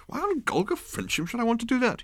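captain lazily baffled by the request; bemused captain. Acted. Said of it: "he asked".

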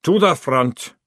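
loud, barked order; sergeant's order. A narrator's reading. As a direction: bark it out loudly.

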